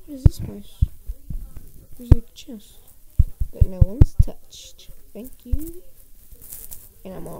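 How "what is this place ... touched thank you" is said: loud, breathy awed whisper; thrilled stage whisper; excited whisper. Real, not acted. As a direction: hiss with delight at the find. Awed whisper finding untouched chest.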